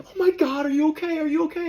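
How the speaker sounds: hammed-up high fretting; worried girls. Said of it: "The girls run over like".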